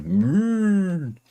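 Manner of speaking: high, lowing cow voice on the moon; a silly high cow voice mooing the word; cow impression. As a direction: moo the answer like a cow. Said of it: "You didn't even see my cow impression".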